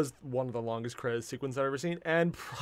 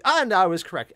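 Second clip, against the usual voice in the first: triumphant; 4 semitones higher, 9 dB louder than usual. Real, not acted. Triumphant about guess.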